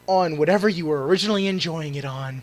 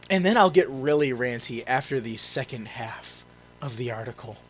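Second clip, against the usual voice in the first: normal talking voice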